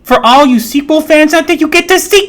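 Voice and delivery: very high, loud fake hype; mock excitement. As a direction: scream it like a fangirl. Sarcastic hype for sequel fans.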